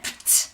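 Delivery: "Pt" voiceless, just the two consonants; unvoiced little burst on "Pt"; t sound. Shown as a demonstration. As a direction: isolate "Pt" with a released t. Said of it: "t sound".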